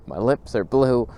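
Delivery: normal talking voice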